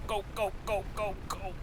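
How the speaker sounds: urgent high go go go; being chased. Real, not acted. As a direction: hurry the boat away. Fleeing the eel.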